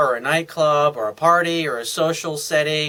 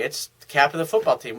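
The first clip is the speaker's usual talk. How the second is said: ordinary talk, nothing marked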